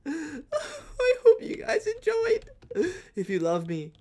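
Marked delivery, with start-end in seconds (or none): 0.1-2.5 very high, slow, laughing through a fake sob; giggling mock sob, very high and slow; laughing-crying. Real, not acted. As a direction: squeak it out between laughs. Signing off after the loss.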